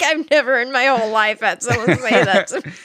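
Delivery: retold reply spilling out through laughter; laughing. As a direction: quote him while still cracking up.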